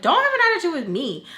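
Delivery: high, loud, fast retort; indignant talking back. Retold or imitated. Teen talking back to stepfather on the phone, indignant. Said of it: "and I tell him".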